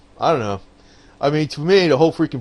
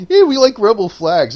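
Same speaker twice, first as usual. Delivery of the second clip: higher and louder sarcastic rally cry; mock campaign cheer; 9 semitones higher, 8 dB louder than usual. Mocking Democrats' old campaign, loud high sarcastic cheer. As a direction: ham it up like a cheering supporter.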